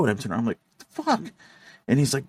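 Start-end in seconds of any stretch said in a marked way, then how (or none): 0.8-1.2 startled. Retold or imitated. Driver confused turning around.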